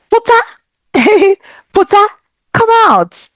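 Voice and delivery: high, laughing call to come out; coaxing friend. Acted. Scene: friend calling a housebound friend outside, high laughing coax. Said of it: "they're like".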